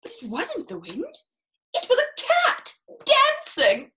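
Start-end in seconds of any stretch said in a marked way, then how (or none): 1.7-3.9 lifted, louder and slower, full of delight; excited reveal. A narrator's reading. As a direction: announce the dancing cat with wonder. Read-aloud reveal of dancing cat.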